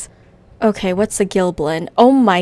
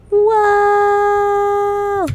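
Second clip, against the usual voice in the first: high, drawn-out whoa; excited whoa; 12 semitones higher, 4 dB louder than usual. Real, not acted. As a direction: ride the launch in delight. Flying out of cannon.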